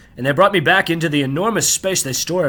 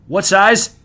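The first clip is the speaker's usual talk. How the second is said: raised, higher call over the shoulder; louder questioning shout, kept moderate; yelling back; 4 semitones higher, 5 dB louder than usual. A narrator's reading. Clerk turns to ask customer a shirt size, yelling back. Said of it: "yells back".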